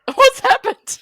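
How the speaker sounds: high, loud, startled "What's happened?"; startled florist. Retold or imitated. Florist arriving to find the hearse gone, startled and loud. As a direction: blurt it out, caught completely off guard. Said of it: "And she's like".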